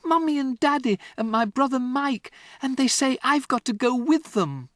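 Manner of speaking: put-on high, urgent child's account; upset girl. Matilda to Miss Honey, high and urgent. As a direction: pour it all out.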